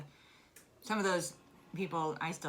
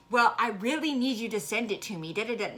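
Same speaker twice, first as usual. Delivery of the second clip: high, loud, pushy mock of a demanding woman; mocking quote; 6 semitones higher, 7 dB louder than usual. Mocking the Girl Scout leader's demand to the grieving daughter, high and pushy. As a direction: sneer it out like she couldn't care less. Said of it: "Her answer was".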